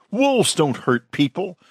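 high-pitched mild protest; lightly voiced pleading woman; crying out. A narrator's reading. Woman defends the wolves to the crowd, crying out mildly. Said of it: "a woman cried".

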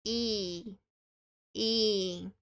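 "i" held as a long ee; long ee sound. Shown as a demonstration. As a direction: draw out "i" like a long ee. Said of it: "esse som longo".

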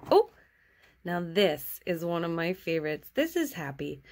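ordinary talk

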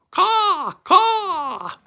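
very high screeching caw; eagle cry. Pretend.

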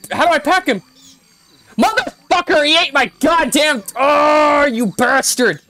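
furious high shouting at a game creature; angry yell. Real, not acted. Gamer yelling at the creature that ate his pet, furious shout. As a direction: scream at the thief that ate your pet.

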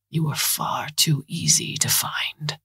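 whispered, slow taunt. A narrator's reading. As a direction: breathe the threat out slowly. Villain's taunt, whispered slowly.

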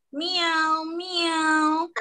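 drawn-out, spoken "meow, meow"; imitated cat meow. Shown as a demonstration. Teacher showing children animal sounds, lightly imitated meow. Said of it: "different animals with their different sound".

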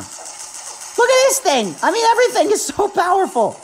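amazed. Real, not acted. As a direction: gush over the weapon. Amazed by powerful weapon.

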